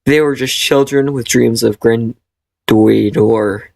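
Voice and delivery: normal talking voice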